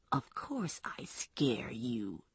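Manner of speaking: низкий, медленный, угрожающий шёпот; медленно, низко и с угрозой; menacing Kenny. Acted. Kenny threatens her with knife.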